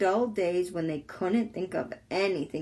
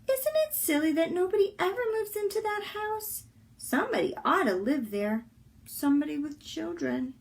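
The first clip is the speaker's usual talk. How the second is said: put-on children wishing; 7 semitones higher than usual. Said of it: "say to each other".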